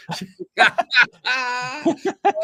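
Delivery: squealing high laughter; high laughing fit. Real, not acted. Cohost cracking up at his own wife joke, high laughter. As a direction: break into helpless giggles.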